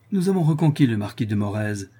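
plain reading voice

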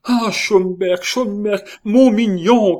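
high, loud, imploring cry. A narrator's reading. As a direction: call out to a beloved favourite. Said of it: "cria Henri". (cri implorant, voix aiguë)

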